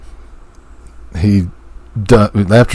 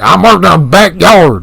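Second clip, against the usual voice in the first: put-on Southern accent; 9 semitones higher, 4 dB louder than usual.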